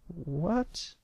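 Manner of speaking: high, small rising question; puzzled little boy. Acted. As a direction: ask it in a tiny child's voice. Tearful little colt questions brother.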